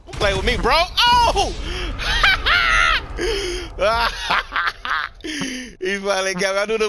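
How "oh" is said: high loud cackle of disbelief; shrieking laugh. Real, not acted. Gamer shriek-laughing after finally being killed.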